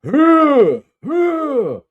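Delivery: very high, loud owl hoot; piercingly high imitated owl call. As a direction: imitate an owl calling across a river. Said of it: "this owl".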